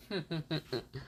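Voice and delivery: hushed, acted villain snicker; villainous chuckle. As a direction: snicker softly like a scheming villain. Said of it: "Orochimaru laughs and says".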